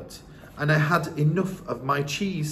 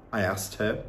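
usual speaking voice

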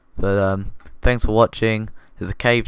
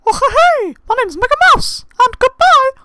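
much higher, louder squeaky cartoon mouse falsetto; Mickey Mouse voice; 9 dB louder than usual. Gamer signing off his video as a cartoon mouse, squeaky and loud. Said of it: "I'm gonna do an impression of Mickey Mouse".

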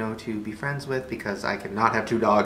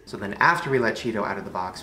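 usual speaking voice

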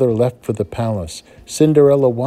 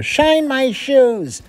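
bossy stepsister; 4 dB louder, much slower than usual. Acted. Older stepsister barks an order.

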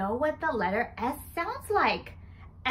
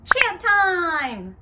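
normal talking voice

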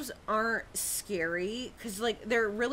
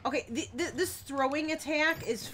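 stammer, then much higher and louder; frustrated yell building; 8 semitones higher, 4 dB louder than usual. Real, not acted. Frustrated by throwing attack.

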